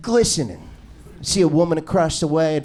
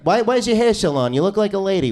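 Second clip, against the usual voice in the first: normal talking voice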